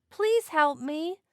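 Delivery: put-on a lost baby begging for rescue; crying out. A narrator's reading. Stranded baby whale calling for help, slow and pleading. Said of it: "he cried".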